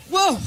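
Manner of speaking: hacking cough then a startled whoa; coughing fit. Real, not acted. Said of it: "I get allergic".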